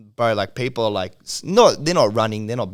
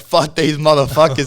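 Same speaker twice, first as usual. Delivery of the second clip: a louder mock yell, imitating the drunk gunman; angry yell; 4 semitones higher, 8 dB louder than usual. Retelling the drunk guy with the shotgun, an imitated angry yell. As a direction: bark it out like the drunk bloke with the shotgun. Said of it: "And he was like".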